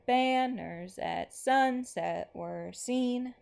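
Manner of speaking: slow, lilting recitation that rises on each line end; slow poem reading. Acted. Teacher reads the next poem to the class, slow and rhythmic. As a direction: chant it gently in its galloping rhythm.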